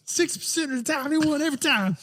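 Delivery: very high, strained put-on voice; hammed-up high-pitched impression; mock promo voice. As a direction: deliver a joke boast, pitched far above normal. Joke quote in put-on wrestler voice.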